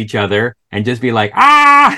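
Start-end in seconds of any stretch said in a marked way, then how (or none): 1.4-2.0 high loud Pee-wee yell; word of the day scream. Retold or imitated. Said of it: "This kind of thing".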